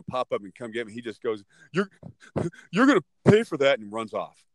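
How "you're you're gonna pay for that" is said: loud, higher-pitched empty threat; mock parting threat. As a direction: imitate a beaten bully yelling as he retreats. Beaten bully's parting threat, acted.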